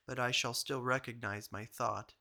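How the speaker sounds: ordinary delivery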